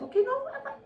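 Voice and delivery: high, laughing okay whatever; squeaky giggling brush-off; laughing dismissal. Retold or imitated. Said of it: "I'm like".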